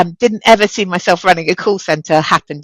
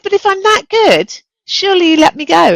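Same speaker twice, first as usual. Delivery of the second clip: much higher, louder protesting reasoning; incredulous plea; 11 semitones higher, 4 dB louder than usual. Retold or imitated. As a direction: push back in disbelief at the boss. Arguing with the boss.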